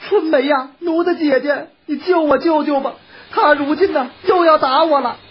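high, urgent cry for help; frightened pleading. A narrator's reading. Wife begging her maid to save her from a beating, frightened. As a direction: beg in a high woman's voice.

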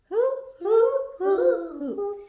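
high owl hoot. Shown as a demonstration. Mother showing owl sound.

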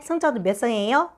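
ordinary talk, nothing marked

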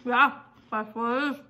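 speaking around a bite; talking while chewing. Real, not acted. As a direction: mouth partly full. Seen 2: Snack put in mouth at -5; jaw and lips keep chewing while talking.

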